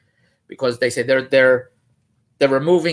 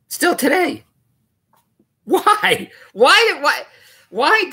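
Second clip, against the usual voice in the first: incredulous; 11 semitones higher, 3 dB louder, much slower than usual. Real, not acted. Indignant outburst at the law.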